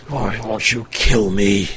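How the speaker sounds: low, slow, weakened wizard voice; shuddering wizard. Acted. Revived wizard begs the party for death, shuddering. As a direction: groan it slowly, barely revived. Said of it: "he's sort of shuddering".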